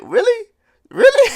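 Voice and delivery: really high, loud disbelief breaking into a laugh; incredulous. Real, not acted. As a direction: squeak it out in disbelief. Mocking the fleeing Kaku's threat.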